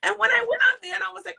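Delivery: ordinary talk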